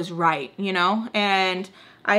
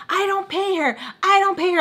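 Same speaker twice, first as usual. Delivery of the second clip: higher, mock voice of him; imitating him, a higher mocking voice; mocking his voice; 10 semitones higher than usual. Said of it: "I'm, like, telling him".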